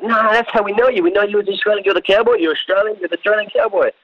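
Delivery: high-pitched, eager insistence; insistent fans. Retold or imitated. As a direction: imitate excited fans who won't take no. Friends insisting on the nickname.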